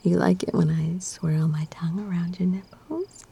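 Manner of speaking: normal talking voice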